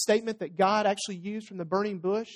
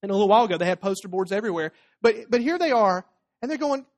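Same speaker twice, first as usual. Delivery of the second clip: normal talking voice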